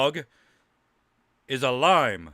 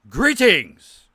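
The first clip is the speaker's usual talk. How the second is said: a grand in-character hello; greeting in character; 7 semitones higher, 6 dB louder than usual. Acted. Player greets the table in character, grandly.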